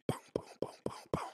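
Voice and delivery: soft breathy boom boom boom; whispered fight sound effects; whispered punch sounds. Retold or imitated. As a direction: tap out the punches under your breath.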